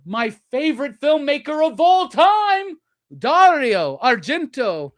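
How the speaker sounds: high, loud gushing, name drawn out; real fan excitement. Real, not acted. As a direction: gush loudly over a favorite. Raving about favorite filmmaker's tape.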